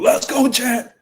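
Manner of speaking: high-pitched boxer's challenge call; Shannon Briggs heckling. Acted. Streamer storming the weigh-in to challenge a fighter, hyped heckling. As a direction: holler it like a heckler busting in. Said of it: "on my Shannon the Briggs shit".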